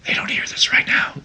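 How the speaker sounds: hushed aside in a whisper. Real, not acted. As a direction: whisper as if listeners can't hear. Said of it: "great on a podcast to whisper".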